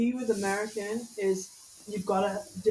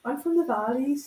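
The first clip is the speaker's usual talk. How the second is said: lilting Valleys Welsh, pitched up a little; Welsh accent; 3 semitones higher than usual. Shown as a demonstration. Showing friends a key sentence to get into a Welsh accent. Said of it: "Like in, in Welsh".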